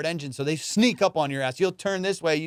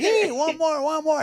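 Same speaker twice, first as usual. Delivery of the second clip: eager begging; 11 semitones higher, slower than usual. Retold or imitated. JR begging to keep fishing.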